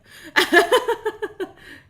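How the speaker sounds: loud, high string of laughs; high giggling laugh. Real, not acted. Player patting a head in game, high laugh.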